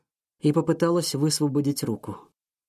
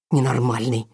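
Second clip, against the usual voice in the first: heated outburst. A narrator's reading. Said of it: "с жаром выпалила она".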